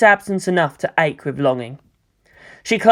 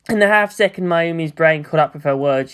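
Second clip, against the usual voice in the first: plain reading voice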